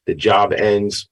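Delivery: usual speaking voice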